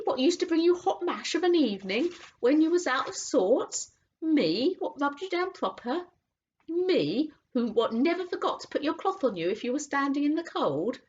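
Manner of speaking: high, loud wounded cockney; indignant cabby. Acted. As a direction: protest like a hurt old friend. Cabby hurt by horse.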